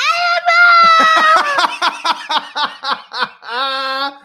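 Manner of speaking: high loud laugh; dad cracking up at his son's shout; big burst of laughter. Real, not acted. Son shouts a name.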